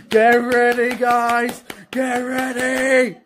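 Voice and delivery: loud, building get ready; excited hype. Real, not acted. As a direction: pump up the crowd. Hyping up viewers before countdown.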